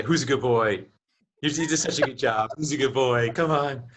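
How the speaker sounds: cooing at a dog, lightly voiced; pet talk. Salesman jokingly cooing at a customer like a pet, lightly voiced. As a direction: praise them like a good dog, mock. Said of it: "talking to 'em like they're your pet".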